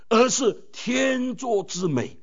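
天作之美 drawn out slowly; slow emphasis. Shown as a demonstration. Preacher contrasting fate with heaven's match, slow. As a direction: linger over 天作之美. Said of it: "不是单单盲目的缘分".

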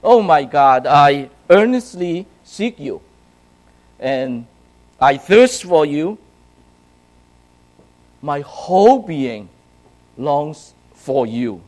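loud, earnest reading of the psalm, slowing at the end; fervent prayer. Retold or imitated. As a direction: pray it out loud like David longing for God. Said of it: "And he says".

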